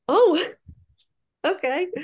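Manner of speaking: high startled oh, then a laughing okay; surprised. Retold or imitated. To the first midwife, a startled high oh okay. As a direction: squeak it out, caught off guard.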